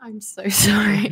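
hushed, slow whisper. Acted. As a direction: breathe the apology. Apologizing in a whisper.

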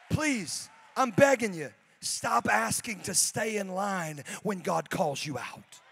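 loud building sermon climax; full-force preaching. Real, not acted. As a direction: drive the point home.

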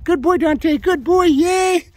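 high excited dog praise. Real, not acted. Trainer praising the pup for the fetch, high and loud. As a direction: cheer the dog on, bright and loud.

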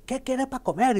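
imitating a Cuban restaurant waiter, high and loud; Latin American waiter. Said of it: "voy al restaurante y me dicen".

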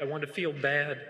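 ordinary talk

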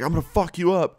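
high-pitched imitation of a threat; mock threat. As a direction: mimic a riled-up guy threatening someone. Imitated dude threatening him.

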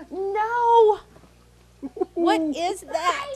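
startled cry, high and loud. Real, not acted. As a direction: jump at the sight. Sees a spider.

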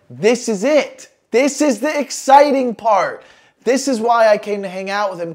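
thrilled this is the fun part; building excitement, voice lifted; excited. Retold or imitated. Excited anticipation of getting high.

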